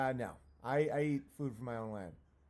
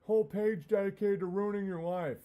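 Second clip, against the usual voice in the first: angry fan; 5 semitones higher, 4 dB louder than usual. Retold or imitated. Fan voice turns to threats.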